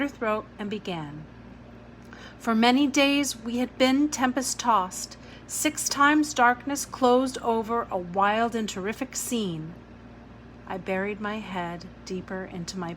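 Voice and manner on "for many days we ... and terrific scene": louder storybook reading; reading aloud. Acted. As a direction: read it like a bedtime story. Reads book aloud to children.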